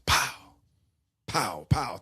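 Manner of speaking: mouth-made gunshot pops; imitated gunshots. Narrating Norma shooting Joe, mock gunshot pops. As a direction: pop out the shots with your mouth.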